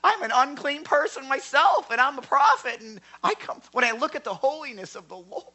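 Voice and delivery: high, strained confession of unworthiness; high, anguished woe is me; humbled prophet. Retold or imitated. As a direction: cry it out, overwhelmed. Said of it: "he fell down saying".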